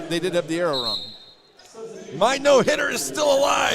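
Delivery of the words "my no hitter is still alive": high, loud celebratory shout; triumphant shout. Real, not acted. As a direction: crow it loudly over the crowd. Commentator celebrates his own streak.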